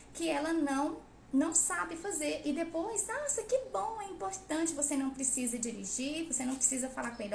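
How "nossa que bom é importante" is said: high mocking "Nossa, que bom"; mock praise. Mocking parents who praise wrong writing, high gushing voice. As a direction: parody a gushing, approving parent.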